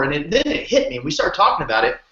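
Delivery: usual speaking voice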